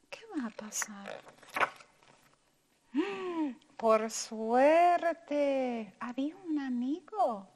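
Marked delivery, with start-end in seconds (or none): 0.1-1.2 suspense whisper. Acted. 2.9-3.5 short quiet gasp of suspense; soft gasp. Acted. Teacher gasping for the children, soft suspense.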